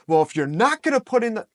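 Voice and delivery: mock exasperated reaction, high and loud; scolding coach. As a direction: scold the athlete, as a bad example. Acting out the wrong coach reaction.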